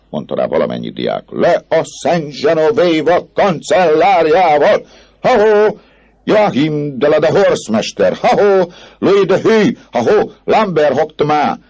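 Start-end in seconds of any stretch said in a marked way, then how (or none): 1.3-11.6 very high, loud calls and halloos; rowdy student shouting; crowd jeering. Acted. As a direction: holler names across the square. Crowd hails and jeers officials.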